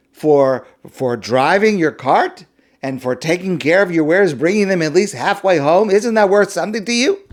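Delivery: high, loud, pushy haggling; wheedling thief. Acted. Thief pressing the merchant to pay him, brazen and wheedling. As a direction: cheekily demand payment for the stolen goods.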